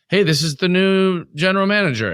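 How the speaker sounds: spring the news with put-on excitement; mock announcement. Acted sudden announcement of new manager.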